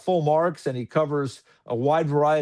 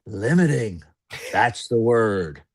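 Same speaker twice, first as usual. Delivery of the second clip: lower, slow, a light imitation of an older broadcaster; drawn-out mock approval in a lowered voice; Sam Rosen's voice; 7 semitones lower, slower than usual. Retelling Sam's reply to him, lightly imitated lower and slower. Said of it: "And Sam went".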